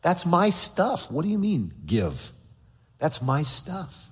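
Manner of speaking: slow, grudging mine-mine voice; slow, possessive mock complaint; possessive attitude. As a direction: drag it out like a stingy child. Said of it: "this attitude towards God that says".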